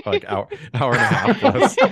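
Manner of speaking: high, loud, laughing through the words; real laughter at a long film. Real, not acted. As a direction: crack up at the runtime.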